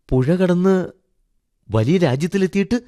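put-on high, eager child's voice; high childlike prompting; curious little boy. A narrator's reading. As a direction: ask like a small boy wanting a tale. Boy quietly asks master for story.

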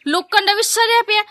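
ordinary talk

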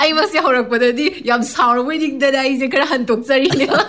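half-laughing after a fierce performance. Real, not acted. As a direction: react with a real laugh in the words. Host reacts laughing to fierce monologue.

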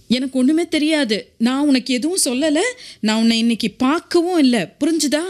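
high-pitched, insistent confidential voice; urgent secretive denial. A narrator's reading. Maria disowns her warning to the mother, high and insistent. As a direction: deny all knowledge, pressing to be understood. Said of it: "சிறிது உரத்த குரலில் ரகசியமாக சொன்னாள்".

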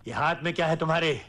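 fast, full-voiced question; suspicious demand. Father questioning what his son holds, quick sharp demand. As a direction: repeat it aloud and press for an answer.

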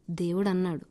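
ordinary delivery, nothing marked

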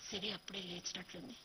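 ordinary delivery, nothing marked

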